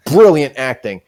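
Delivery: usual speaking voice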